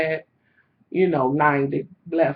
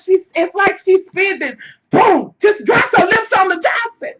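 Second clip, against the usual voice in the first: excited retelling; 10 semitones higher, 4 dB louder than usual. Retold or imitated. Retelling the table fall.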